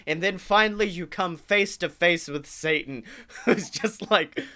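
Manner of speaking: ordinary talk, nothing marked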